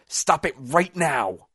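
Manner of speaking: high, loud and drawn out; slow, forceful, strained order; urgent hissed command. A narrator's reading. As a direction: demand silence, stretching each word. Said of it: "Roop hissed".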